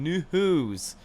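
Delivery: News drawn out with a breathy hitch; mumbled word. Acted. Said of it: "sorry for my mumbling".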